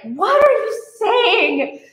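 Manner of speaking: high, slow, shocked what are you saying; shocked question. Retold or imitated. A schoolgirl shocked by classmates saying hell. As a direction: recoil at a word you think is rude. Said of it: "And I was like".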